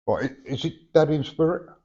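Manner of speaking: ordinary talk, nothing marked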